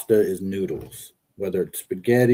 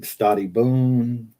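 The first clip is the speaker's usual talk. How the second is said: slow Italian Stati boon with long boon; light mock Italian goodbye; imitating Gianni; much slower than usual. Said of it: "Gianni's always speaking Italian".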